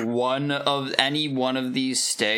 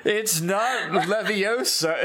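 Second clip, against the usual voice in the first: much higher prim correcting voice; Hermione impression; 5 semitones higher than usual. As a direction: correct them like a know-it-all. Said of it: "correct her on her pronunciation".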